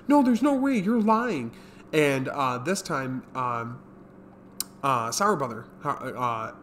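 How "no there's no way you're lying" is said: high, loud, panicky refusal; frantic disbelief. Retold or imitated. Kijino refusing to believe Taro, high panicked denial. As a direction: wail it out like he can't accept it.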